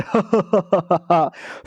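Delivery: long triumphant ha-ha laugh; put-on laugh. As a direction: laugh in a drawn-out run of ha-has. Narrator's acted laugh after barrier breaks.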